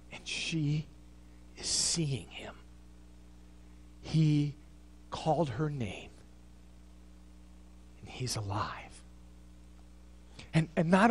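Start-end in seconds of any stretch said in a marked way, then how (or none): 0.1-8.9 whispered, much lower; reverent whisper. Acted. Preacher whispering to the congregation that he is alive. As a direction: whisper it in awe.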